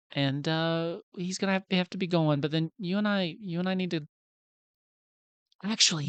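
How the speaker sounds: put-on young voice, coaxing a kid along; Luca. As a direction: cover up awkwardly, stumbling over the words. Plays Luca covering up to Nellie.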